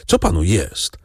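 ordinary delivery, nothing marked

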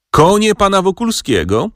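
high, ringing call from the hall; doorman calling out. A narrator's reading. Doorman calls Wokulski's horses from the hall, a high ringing call. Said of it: "zawołał z sieni Szwajcar".

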